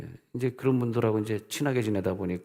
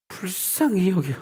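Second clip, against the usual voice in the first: tender compassion; 9 semitones higher, slower than usual. Acted. Preaching Jesus' compassion.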